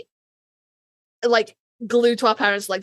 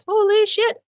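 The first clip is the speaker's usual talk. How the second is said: surprised; 10 semitones higher, 3 dB louder than usual. Real, not acted. Reacting to follower count.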